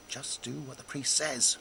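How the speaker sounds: hint of a wife hissing an order; wife hissing back. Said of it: "she hissed back".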